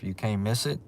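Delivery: normal talking voice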